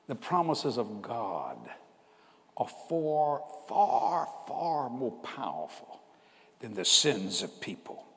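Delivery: soft, slow solemn statement; solemn divine word. Retold or imitated. God telling Jesse a promise, soft and slow. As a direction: deliver it like a revelation.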